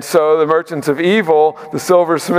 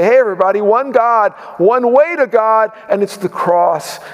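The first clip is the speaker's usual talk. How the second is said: raised, high-pitched call to everybody; rallying proclamation; 7 semitones higher than usual. Acted. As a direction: proclaim it to the whole crowd, pitched up. Church rising up to proclaim.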